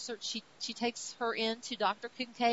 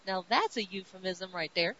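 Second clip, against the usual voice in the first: usual speaking voice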